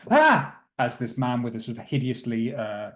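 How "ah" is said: sudden high loud frightened cry; a sharp scared shriek; startled prisoners' yelp. Acted. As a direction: yelp in fright at the face in the doorway. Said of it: "they look up and go".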